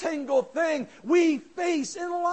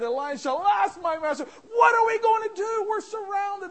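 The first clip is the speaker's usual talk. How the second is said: shouted, higher, alarmed plea; panicked cry; 6 semitones higher, 5 dB louder than usual. Acted. Servant cries to his master in panic, high shout.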